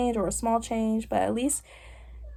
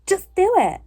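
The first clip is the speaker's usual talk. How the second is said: higher, louder, sweeping order; blunt command; 10 semitones higher, 5 dB louder than usual. Acted. Summing up her broad advice, blunt higher-pitched command. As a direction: toss it out as a punchy command. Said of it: "a little bit broad and very".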